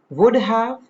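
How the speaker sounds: "Would have" as two full words, pitched high; full form. Shown as a demonstration. Teacher presenting the unreduced form, clearly articulated. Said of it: "Reduced in casual speech".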